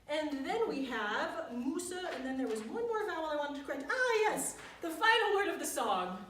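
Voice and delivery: ordinary talk, nothing marked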